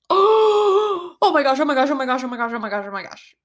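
high gasp tumbling into rapid muttered panic; freaking out. Retold or imitated. Teacher sees the naked student, mock panic fading fast. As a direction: start high and loud, sink while repeating. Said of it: "I was freaking out".

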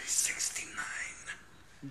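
slow, drawn-out whisper; joking whisper. Pretend. Co-host topping the episode number joke, slow whisper. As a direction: whisper it slowly as a naughty aside.

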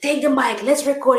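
high, loud and eager; retold eager command. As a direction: re-enact rushing someone to the mic. Retells rushing to record the new song.

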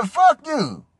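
high mock-female cursing; angry woman. Date cursing him again, mock angry. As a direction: imitate her last curse in a high voice.

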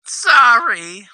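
playful rising sorry; high mock apology. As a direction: sing out a fake apology. Mock apology, higher take.

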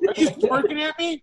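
laughing while repeating the line. Retold or imitated. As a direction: laugh through it.

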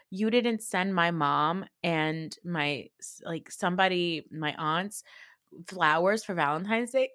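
ordinary talk

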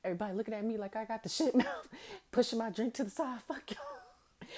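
hint of a fast, comedian rant; lightly voiced stand-up routine; Kevin Hart bit. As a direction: rattle it off, ending in a high yelp. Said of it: "Kevin Hart stand-up when he was like".

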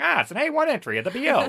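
put-on high, loud, fast old-timey patter; old-timey guys. Mocking the headline as a Simpsons gag, old-timey voice. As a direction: rattle it off like a hammed-up cartoon old-timer. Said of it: "like, some old-timey guys".

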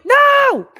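sudden high shriek of alarm; panicked scream. Real, not acted. Startled by sudden silent mic.

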